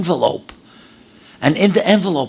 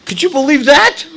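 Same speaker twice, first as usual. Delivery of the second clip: much higher and louder; amazed; 9 semitones higher, 5 dB louder than usual. Real, not acted. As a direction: call out in wonder. Marvelling at the story.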